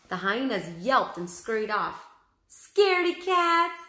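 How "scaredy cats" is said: high, loud slow taunt; taunting shout. A narrator's reading. Annie shouting after the fleeing hyenas. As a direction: jeer after them as they run. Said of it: "Annie shouted after them".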